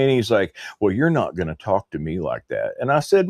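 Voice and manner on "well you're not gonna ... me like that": ordinary talk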